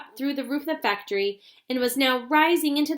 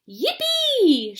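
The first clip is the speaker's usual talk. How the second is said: loud, much higher whoop of joy; loud joyful yell; gleeful shout; 11 semitones higher, 6 dB louder than usual. Acted. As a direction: whoop it out with glee. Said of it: "shouted Grandpa Joe".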